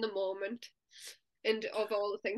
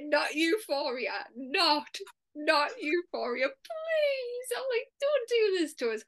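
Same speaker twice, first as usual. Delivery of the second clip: high drawn-out mock plea; pleading; 5 semitones higher, slower than usual. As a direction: beg to be spared, stretching the words, pitch climbing high. Acts out dreading euphoria.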